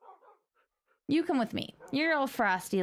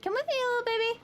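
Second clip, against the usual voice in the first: high cooing pet voice; 12 semitones higher, faster than usual. Real, not acted. Coaxing pet mob.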